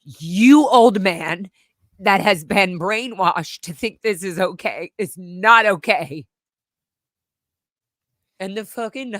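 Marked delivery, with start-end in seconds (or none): 0.0-6.3 loud, slow, scolding shout; angry rebuke. Retold or imitated. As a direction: tell the old man off.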